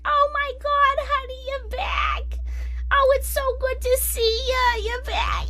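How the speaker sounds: high, excited gushing nurse voice. Retold or imitated. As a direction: gush like a delighted nurse greeting a regular. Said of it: "they'd be like".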